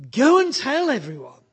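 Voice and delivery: high, loud, rousing charge to go and tell; full-force preaching. Acted. Preacher voicing Jesus sending his disciples out, with rousing force. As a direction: preach it out like a commission.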